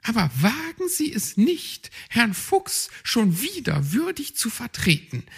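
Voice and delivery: high magpie hissing a warning; hissed warning. Acted. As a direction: warn him sharply, high. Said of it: "zischte Frau Elster".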